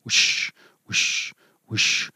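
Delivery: breathy whoosh of rushing blood. Shown as a demonstration. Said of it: "which sounds like a whoosh".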